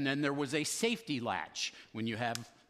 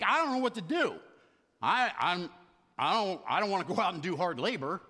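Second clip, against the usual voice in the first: raised-pitch mock panic; lightly hammed-up fretting, louder at first; acted panic; 3 semitones higher, 4 dB louder, faster than usual. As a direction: play the manager starting to panic. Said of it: "he starts to panic".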